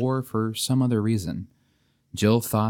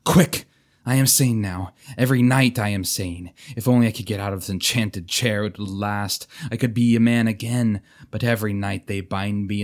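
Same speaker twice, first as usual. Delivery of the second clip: urgent, loud pleading knight; loud and clear; 2 semitones higher, 3 dB louder than usual. Acted. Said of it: "he said loud and clear".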